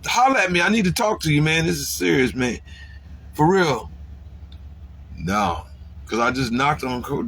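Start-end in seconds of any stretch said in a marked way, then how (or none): 0.0-3.9 pitched-up pleading shout at a friend's door; calling him out. Retold or imitated. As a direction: yell through the door for him to come out. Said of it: "I'm like".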